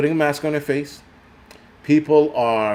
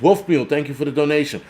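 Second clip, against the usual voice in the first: usual speaking voice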